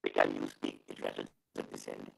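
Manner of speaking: barely audible through static; dropping out on bad line; loose cable crackle. Real, not acted. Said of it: "Now you're not audible at all".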